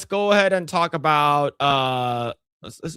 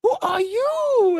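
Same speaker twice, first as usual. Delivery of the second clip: put-on very high, slow old woman voice; mock old lady; slower than usual. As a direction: croak it like a curious old lady. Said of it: "the old lady's like".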